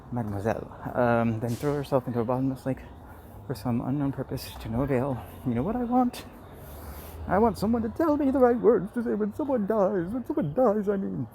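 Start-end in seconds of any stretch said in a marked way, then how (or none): 5.6-11.3 high, loud comic diva voice; Madeline Kahn. Acted. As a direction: belt it like a theatrical diva. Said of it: "call it my Madeline Kahn".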